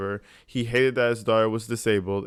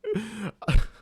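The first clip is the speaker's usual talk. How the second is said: high laugh; 10 semitones higher than usual. Real, not acted. Reacting to courtroom clip.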